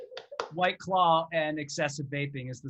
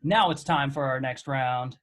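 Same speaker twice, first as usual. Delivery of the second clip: normal talking voice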